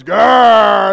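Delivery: loud shouted growl. Retold or imitated. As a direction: roar like the giant champion. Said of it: "he steps forward and he goes".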